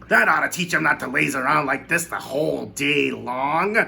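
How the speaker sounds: high, loud, sneering voice; a cruel aunt suggesting a cruel punishment; mean Aunt Sponge proposing the well; spiteful aunt. A narrator's reading.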